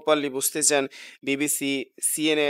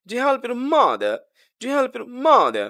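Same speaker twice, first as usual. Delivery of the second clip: slow, high question leaning on "mother"; teacher's slow yes-no question demo; rising tone question; 6 semitones higher, 4 dB louder, slower than usual. Shown as a demonstration. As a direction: pitch "mother" up, drawn out. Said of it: "rising tone হবে".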